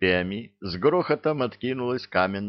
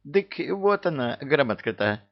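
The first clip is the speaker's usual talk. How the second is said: high-pitched folksy voice; servant producing proof; 7 semitones higher than usual. A narrator's reading.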